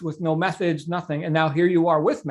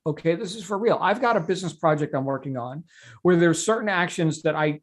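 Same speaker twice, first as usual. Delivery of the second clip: ordinary delivery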